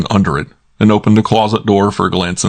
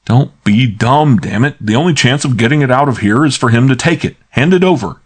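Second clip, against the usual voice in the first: insistent order; 6 semitones higher, 3 dB louder than usual. Acted. Archie pressing Naya, rising urgency.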